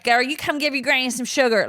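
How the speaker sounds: lightly voiced Texas grandma calling for kisses; granny from Texas demanding sugar, mild Texan accent. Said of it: "her granny was very Texas".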